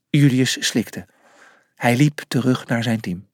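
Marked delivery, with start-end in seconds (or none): none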